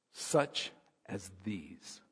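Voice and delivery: soft, very slow and gentle; a hushed, tender phrase; slow tender Jesus. Retold or imitated. Preacher voicing Jesus to the children, soft and slow. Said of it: "He said".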